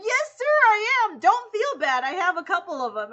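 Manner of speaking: cheerful firm yes; eager to reassure; insistent. Retold or imitated. The vlogger insisting on the gift.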